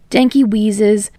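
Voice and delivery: plain reading voice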